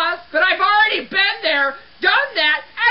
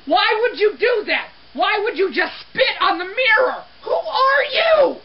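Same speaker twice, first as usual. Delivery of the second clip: outraged rant; 5 semitones higher than usual. Acted. Cleaner to whoever spat on the mirror, outraged rant. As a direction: rise from disbelief into a stretched, high yell.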